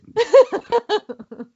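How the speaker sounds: high-pitched chuckling; real laugh. Real, not acted. Host hears the dogs went unnoticed, laughing.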